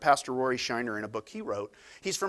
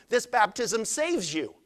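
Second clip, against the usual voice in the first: normal talking voice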